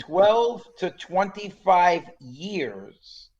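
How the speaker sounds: put-on slow, emphatic Italian-accented "Twelve to twenty-five years"; a light Italian waiter lecturing slowly. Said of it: "your Italian accent is excellent".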